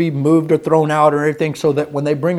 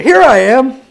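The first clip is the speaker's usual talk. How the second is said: put-on higher, louder cheeky child; mock little boy jumping out of the box; child popping out; 8 semitones higher, 5 dB louder than usual. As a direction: pop up like a boy hiding. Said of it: "and I said".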